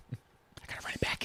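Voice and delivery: hushed conspiratorial whisper. Real, not acted. Dad whispers his dread to his co-host. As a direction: lean in and whisper it like a secret. Confiding about daughter's homecoming.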